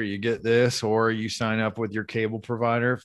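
normal talking voice

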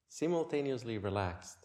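ordinary talk, nothing marked

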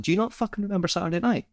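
high-pitched, quick challenge; mock incredulity. Friend winding Kev up about Saturday night, mock incredulous. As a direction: feign disbelief that he could forget. Acted challenge in retold prank.

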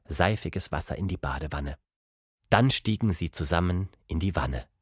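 usual speaking voice

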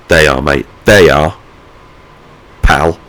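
punchy, curt mock handover; imagined cheeky comeback, last words punched; mock bluntness. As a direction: hand it over with pointed matey emphasis. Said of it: "I didn't say it like that".

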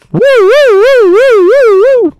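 high loud siren wail; siren imitation.

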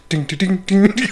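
rhythmic vocal drum taps; playful mouthed percussion; imitated drum beat. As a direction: tap out a beat with the voice. Imitates drums for the song.

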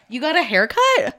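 high-pitched imitation of an admirer; mock gushing, pitched well up; gushing compliment. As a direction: act out a delighted friend noticing. Voicing admirers she hopes for.

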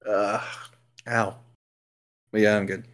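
talking with a sore tongue; carefully, tongue still hurting; just bit tongue. Real, not acted. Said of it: "I bit my tongue".

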